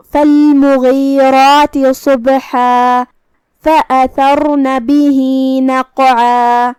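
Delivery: slow, drawn-out melodic tajweed chant; Quran recitation. Shown as a demonstration. Teacher recites the verse to students in a slow melodic chant. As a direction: recite each word slowly and melodically, letting the vowels ring.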